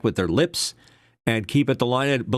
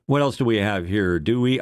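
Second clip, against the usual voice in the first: ordinary talk, nothing marked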